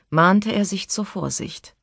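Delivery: usual speaking voice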